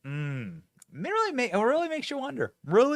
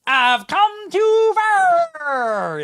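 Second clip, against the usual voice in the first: very slow, much higher drawn-out mocking drawl; mock Southern preacher accent; 5 semitones higher, 5 dB louder, much slower than usual. Host advising Marco Rubio sarcastically, slow mock Southern preacher drawl. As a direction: crank it up like a parody gospel sermon. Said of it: "would have been better for you to say there".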